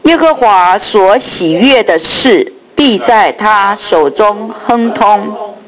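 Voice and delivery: slow solemn group recitation; scripture reading. Real, not acted. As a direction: read the verses slowly together. Congregation reads scripture aloud. (慢速齐声读经)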